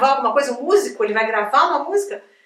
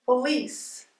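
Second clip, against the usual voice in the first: softer "Police", stressed po-LICE; stress on second syllable; 7 dB quieter than usual. Shown as a demonstration. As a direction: model "Police" softly with -LICE strong. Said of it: "Você saber que a sílaba tônica".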